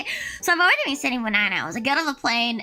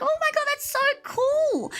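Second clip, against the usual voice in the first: high, sweet auntie voice; imitating the boss. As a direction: mimic the smiley old boss, pitched up. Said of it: "She's like".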